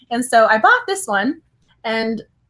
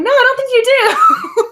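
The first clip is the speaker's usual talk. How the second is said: higher, louder mock-certain no, with a laugh; playful teasing denial; 12 semitones higher, 6 dB louder, slower than usual. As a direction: tease her that she doesn't have that name. Author teasing me at signing.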